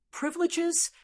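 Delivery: high-pitched incredulous question; mocking amusement. A narrator's reading. Long Zhanwu scoffs at Long Fei's claim, mockingly amused. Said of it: "Long Zhanwu laughed loudly".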